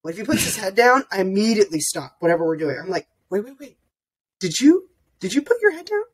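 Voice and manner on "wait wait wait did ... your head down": high-pitched coaxing questions; parent checking on child. Retold or imitated. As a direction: stop and gently query a little one.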